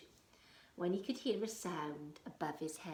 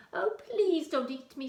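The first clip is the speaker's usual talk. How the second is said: pleading spider; 9 semitones higher, 9 dB louder than usual. Acted. Spider begs the troll.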